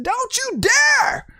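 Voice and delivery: high, slow shout of defiance; retold outcry. To the pastors, a high drawn-out defiant cry.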